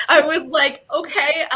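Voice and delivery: high, loud, through real laughter; laughing. Real, not acted. Agent recalling a client's confession, laughing as she speaks. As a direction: struggle to talk while cracking up. Laughing while retelling the call.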